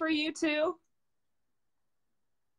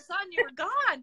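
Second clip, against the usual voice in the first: ordinary talk, nothing marked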